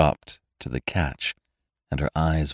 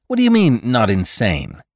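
pitched high and louder at the start; incredulous outburst that drops as it goes; indignant disbelief; 5 semitones higher, 5 dB louder than usual. A narrator's reading. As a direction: demand an explanation, starting high. Helen challenges her brother.

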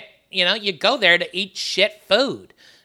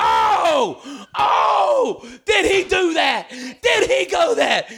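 high excited shouting with a laugh; excited shouts; 10 semitones higher, much slower than usual. Real, not acted. [name] reacting to Kat's fried pie idea, excited shouts. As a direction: whoop with delight.

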